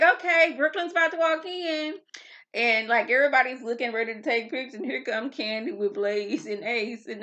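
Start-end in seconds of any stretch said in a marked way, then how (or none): 0.0-1.9 high, loud eager hostess; excited announcement. Retold or imitated. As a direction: hype up the entrance. Voicing hosts at party.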